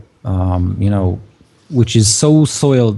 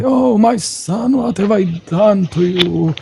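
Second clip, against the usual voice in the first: weeping priest; 10 semitones higher, slower than usual. Acted. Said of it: "starting to weep and cry".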